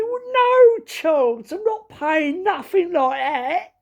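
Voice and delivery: very high, drawn-out Cockney refusal. Retold or imitated. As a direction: refuse in exaggerated East End tones. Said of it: "this guy was proper Cockney".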